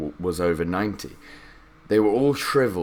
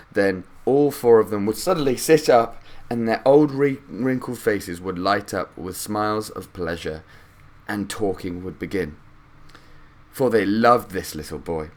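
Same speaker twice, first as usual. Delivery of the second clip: usual speaking voice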